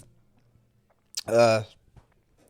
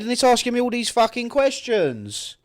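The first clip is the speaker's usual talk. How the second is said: much higher and louder, irritated; exasperated outburst; 11 semitones higher, 7 dB louder than usual. Real, not acted. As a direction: fend off the interruptions, fed up. Friend interrupts while he reads.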